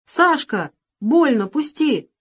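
high slow pained cry; child's cry of pain. A narrator's reading. Little girl cries out to Sasha, in pain. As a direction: cry out that it hurts. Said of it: "девочка не вскрикнула".

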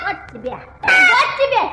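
high mock-fight yell; shout. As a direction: shout while landing blows. Hitting, shouting.

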